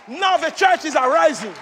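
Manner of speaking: loud fiery preacher, rising and pounding; preaching at full force. Acted. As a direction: thunder it over the congregation.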